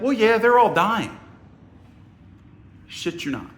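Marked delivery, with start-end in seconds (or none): 0.0-1.2 higher, louder mock-breezy reply; dismissive mimicry. Woman reassuring visitor about bed bugs, dismissive. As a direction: imitate her brushing it off.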